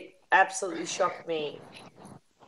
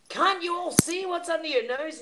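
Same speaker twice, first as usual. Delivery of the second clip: high-pitched imitation of passionate pleading; passionate ranter; 9 semitones higher than usual. Imitating a passionate YouTuber to the group, impassioned plea. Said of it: "one of these passionate guys that just goes".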